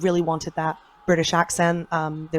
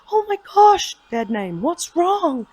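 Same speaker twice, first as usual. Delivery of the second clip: high, loud mock-concerned mother; retold motherly alarm, pitched way up; alarmed mom; 12 semitones higher, 5 dB louder, slower than usual. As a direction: imitate a mom suddenly worried, pitch jumping up. Mom alarmed at sobbing child, retold.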